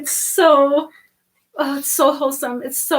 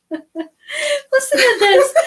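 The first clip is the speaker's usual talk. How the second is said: laughing; 10 semitones higher, 3 dB louder, slower than usual. Real, not acted. Laughing through reading aloud.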